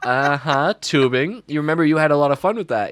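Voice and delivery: a teacher teasing her student about tubing; playful coaxing. Retold or imitated. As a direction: egg him on knowingly. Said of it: "And I go".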